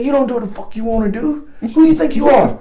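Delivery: mock tirade, rising high on the last question; imitated angry dressing-down. Sergeant major chewing out a soldier, imitated anger. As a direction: retell a sergeant major going off. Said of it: "that guy went off".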